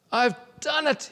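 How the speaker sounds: triumphant thought. A narrator's reading. Boy on the hill, sure he found silence, triumphant. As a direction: crow it like you've won.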